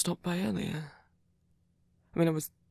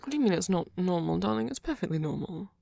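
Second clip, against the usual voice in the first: defensive; 4 dB louder than usual. Acted. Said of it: "I don't mean to get defensive and angry".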